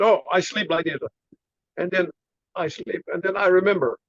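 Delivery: halting Chinese-accented storyteller imitation; imitated Chinese accent. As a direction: imitate the master's simple, Chinese-accented English. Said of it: "I have to do his funny voice like that".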